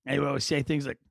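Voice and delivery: ordinary talk, nothing marked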